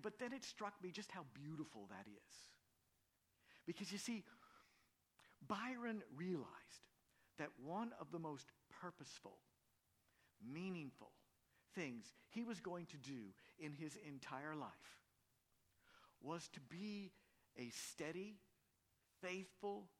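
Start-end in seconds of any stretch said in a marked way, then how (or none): none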